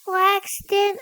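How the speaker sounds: high, imitated child voice; kid cousin calling. Cousin wakes him on the couch, high kid voice. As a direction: call the name like a little cousin. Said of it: "I hear".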